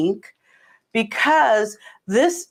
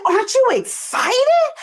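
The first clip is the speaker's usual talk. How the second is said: high-pitched eager imitation; excited, high retold question; retold excitement; 12 semitones higher than usual. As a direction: act out pushing for some excitement. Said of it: "I was, like, all excited".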